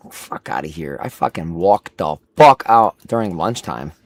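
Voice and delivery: usual speaking voice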